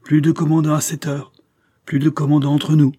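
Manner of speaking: impetuous cry. A narrator's reading. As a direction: burst out at your old friend. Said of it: "s'écria impétueusement".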